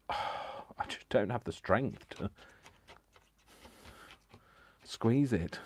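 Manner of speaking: slow, effortful sigh of weakness; straining with effort. Real, not acted. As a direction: squeeze the words out slowly. Struggling to squeeze glue bottle.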